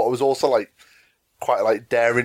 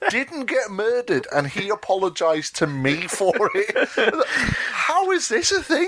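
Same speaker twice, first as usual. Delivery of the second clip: incredulous, rising higher as it goes; amused disbelief; 7 semitones higher, slower than usual. Retold or imitated. Clerk amazed the big customer apologised, amused disbelief. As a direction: marvel at getting away with it. Retells disbelief at surviving the prank.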